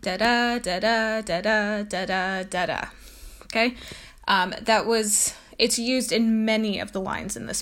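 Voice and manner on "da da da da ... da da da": rhythmic iambic chanting. Shown as a demonstration. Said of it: "it sounds like".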